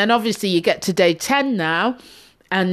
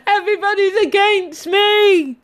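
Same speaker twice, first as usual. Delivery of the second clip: mock tearful wail; 13 semitones higher than usual. Mocking her period moods in a tearful wail. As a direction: sob it out, half laughing. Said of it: "I'm like".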